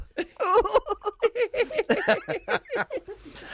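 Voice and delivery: very high squeaky giggle. Pretend. Comedian trying the new Darren Clarke laugh, very high giggle. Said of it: "I'll try it out".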